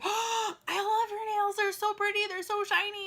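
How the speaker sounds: put-on high, bubbly fan-girl gush. Candace gushing over the narrator's nails, high and bubbly.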